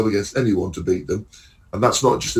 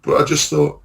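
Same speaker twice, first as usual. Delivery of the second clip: normal talking voice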